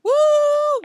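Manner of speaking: very high, loud whoop; yelled whoop. A narrator's reading. Billy dashes out after the pig, yelled whoop. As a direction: let out a piercing yell of glee. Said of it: "yelled Billy".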